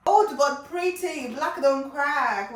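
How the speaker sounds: usual speaking voice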